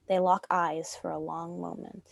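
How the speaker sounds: ordinary talk, nothing marked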